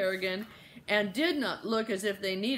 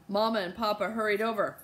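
usual speaking voice

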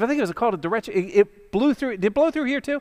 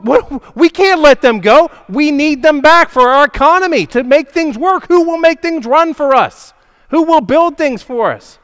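higher and louder, rising alarm; agitated officials; 8 semitones higher, 8 dB louder than usual. Acted. Preacher voicing officials, higher and louder in alarm. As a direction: officials working themselves into a panic.

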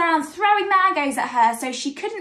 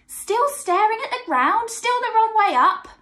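high, slightly loud taunt; jeering animals; 8 semitones higher, 4 dB louder than usual. A narrator's reading. Animals joke at upside-down Nana Sloth, jeering. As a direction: tease the sloth as you go by. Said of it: "they would bark and growl and shriek and howl".

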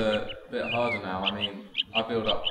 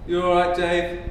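higher and louder greeting; calling out; 8 semitones higher, 7 dB louder, slower than usual. Acted. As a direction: call across the garden. Calling out to neighbour.

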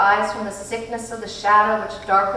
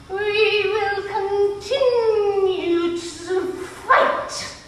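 high, very slow sung line over music; eerie otherworldly voice; 12 semitones higher, 4 dB louder, much slower than usual. A narrator's reading. Missus Witch answering the children, slow eerie singing. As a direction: chant it like an ancient being.

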